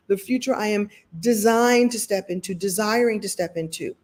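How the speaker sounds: usual speaking voice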